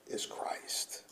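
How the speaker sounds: breathy whispered question; hushed whisper to the congregation. Real, not acted. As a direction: drop to a whisper for the climax.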